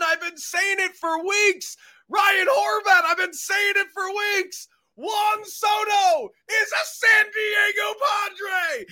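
high, loud, excited shouting. Real, not acted. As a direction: yell the big news with joy. Announcing blockbuster trade, shouting.

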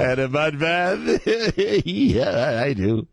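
slow leering drawl. Acted. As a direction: savor it like a dirty old man. Savoring a lewd fantasy.

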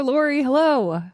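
ordinary delivery

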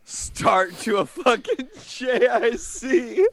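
high, slow, breaking into laughter; laughing while talking. Real, not acted. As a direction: talk through the laughs. Cracking up at story.

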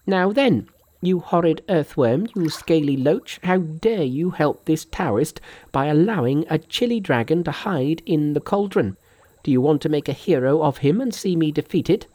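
high-pitched, indignant monkey voice; scolding monkey. A narrator's reading. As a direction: berate the dragon king in a high voice. Monkey scolds the Dragon King.

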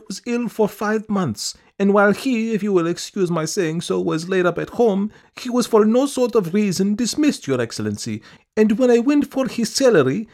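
high, pleading woman's voice; pleading petitioner. A narrator's reading. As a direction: beg in a high, fussy voice. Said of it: "speaking rapidly".

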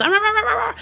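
high, rapid "rah-rah" gibberish; high-pitched runaway chant; frantic crowd babble. Retold or imitated. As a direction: imitate a chant sped up into noise. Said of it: "faster and faster until it was just".

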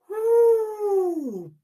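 high, loud whoop of delight; joyful woo. Real, not acted. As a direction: let out a real celebratory woo. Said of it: "Big moment for me".

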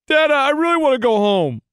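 put-on high, loud whining little kid; five-year-old son. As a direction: imitate a child pleading with dad. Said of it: "my five-year-old looks at me and goes".

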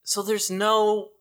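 high, halting complaint; mild frustration. Real, not acted. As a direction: protest haltingly, a bit put out. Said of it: "You always get mad".

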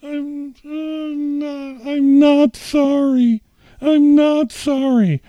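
high, loud and slow; shouting through tears. Retold or imitated. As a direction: scream it out, over and over. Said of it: "And I said".

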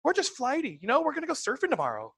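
high, airheaded chatter; high-pitched flighty lady. Acted. Lady with a high voice on the phone, flighty. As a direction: pitch it up like a flighty surfer girl. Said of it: "who have a high-pitched voice".

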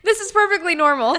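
giggling through fake calm; laughing mock reassurance. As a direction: laugh while insisting all is normal.

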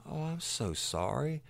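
a hammed-up, high voice of sympathy; mock sympathy. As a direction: pitch it up high, playing the sweetly sorry guy. Man offering sympathy in the story.

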